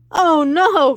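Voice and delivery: high loud frightened cry; child crying out at the witch; alarmed cry. Acted.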